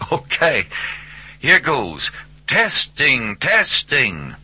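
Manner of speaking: high, playful mic-check delivery; speakerphone voice. A narrator's reading. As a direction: test the line like a good-humored caller. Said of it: "his voice said".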